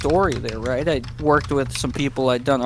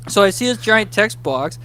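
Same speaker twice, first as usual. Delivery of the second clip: usual speaking voice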